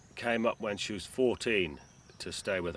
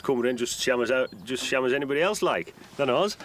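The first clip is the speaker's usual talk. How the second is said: broad Cumbrian, louder and pitched up; Cumbrian dialect; 5 semitones higher, 5 dB louder than usual. Shown as a demonstration. Proving he can speak like the local farmers, in Cumbrian dialect. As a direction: show you can talk like the locals. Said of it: "I can talk like that".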